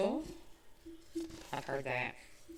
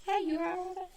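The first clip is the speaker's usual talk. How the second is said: Southern greeting; 13 semitones higher than usual. Shown as a demonstration. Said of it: "Southern way to say".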